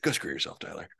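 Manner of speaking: muttered. Retold or imitated. Muttered insult to friend.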